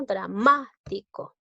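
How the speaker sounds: "Dramático" slowed, with MA drawn out and emphasized; stress on MA. Shown as a demonstration. Teacher showing the class the tonic syllable, stress on MA. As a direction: lean hard on the MA in "Dramático". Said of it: "Lleva la mayor fuerza de voz en la sílaba".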